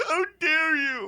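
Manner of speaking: very high, slow, scandalized shriek; outraged pious roommate. Retold or imitated. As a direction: gasp it out like the most offended saint alive. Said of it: "He goes".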